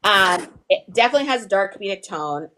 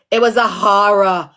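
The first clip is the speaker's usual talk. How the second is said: loud New York "HAH-ruh"; New York accent; 5 dB louder than usual. Shown as a demonstration. Teacher imitating New Yorkers, hammed-up New York accent. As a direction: lean on the New York open "ah". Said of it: "New York say".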